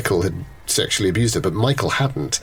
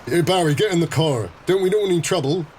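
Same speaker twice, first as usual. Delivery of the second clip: nervous little guy; 6 semitones higher than usual. Acted. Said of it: "This little guy gets out and goes".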